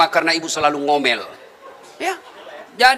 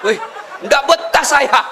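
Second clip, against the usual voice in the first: mock exclaim; 5 semitones higher, 6 dB louder, slower than usual. Jinn fleeing the nagging wife.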